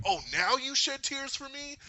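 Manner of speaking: high, loud, drawn-out mocking question; scornful taunt. Gamora to the crying Thanos, mockingly incredulous. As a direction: mimic a woman sneering at his tears.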